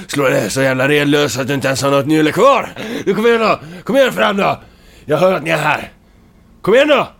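a fired-up furious taunt; shouted threat. Acted. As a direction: yell the threat into the dark. Hero shouts challenge at enemies.

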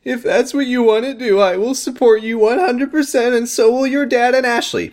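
high put-on supportive mom. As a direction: pitch it way up as the mom. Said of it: "mom voice continues".

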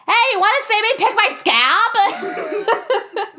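put-on high, slow, giggly little-boy voice; little kid impression. Retelling a movie, she voices the scalp-picking kid, high and slow. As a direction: play the gross little kid offering to pick his scalp. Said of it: "my best impression of this little kid".

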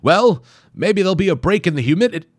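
high, loud, fast chatter; fussy scientist. Acted. Wimple remarking on weather, high and fast. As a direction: babble on obliviously. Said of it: "came a voice".

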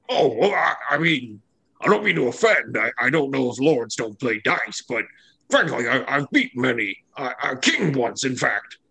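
hesitant, stammering apology; bumbling giant Ock. Acted. Giant reassuring lords he meant no offense, flustered. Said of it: "Ock stands back and he says".

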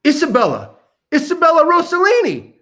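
high, loud hail down the street; calling out. Retold or imitated. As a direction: call her name across the pavement. Calling to passing woman outside.

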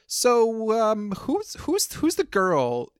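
nosy coworker voice. Retold or imitated. Mimicking coworkers' nosy question.

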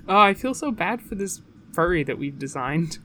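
ordinary talk, nothing marked

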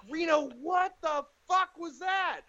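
high-pitched, incredulous manager; exasperated manager. Retold or imitated. Manager confronting his comic after the hug, exasperated. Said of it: "my manager's like".

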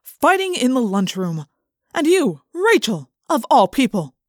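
slow, emphatic scolding; loud, drawn-out reproach; stern principal. A narrator's reading. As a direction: scold like an affronted assistant principal. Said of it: "he demanded".

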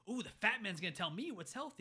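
high-pitched mock amazement; imitated sneering comeback; mocking put-down. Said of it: "kind of like a gut punch moment".

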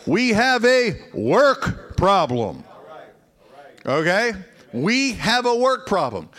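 building pulpit fervor; fervent preaching. Acted. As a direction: preach it with full conviction. Preaching at full force.

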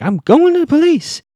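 high, loud over-the-top outburst; mock angry shout. Byron arguing with Ella at home, hammed-up angry shout. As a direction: bellow it high, imitating an irate father.

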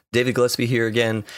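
usual speaking voice

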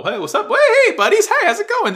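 very high, loud, squeaky hailing; nervous over-friendly greeting. Acted. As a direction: placate armed guards with shrill cheeriness. Judo greeting soldiers aiming guns.

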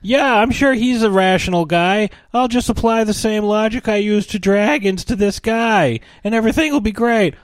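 put-on high-pitched, naive whiny teen; mock a squeaky kid cheerfully reasoning; Hiccup. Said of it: "the next movie is like".

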